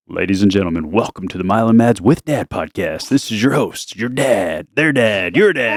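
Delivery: low announcer voice, climbing back up on the repeats; goofy podcast host. Acted. Dad demonstrating his podcast opening, goofy host voice. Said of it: "I say something goofy like".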